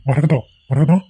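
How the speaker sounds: urgent call for his wife. Acted. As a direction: call her name as you hurry over. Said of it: "எதுக்கு இப்படி கத்திட்டு வரீங்க". (பதற்றமாகக் கூப்பிடுதல்)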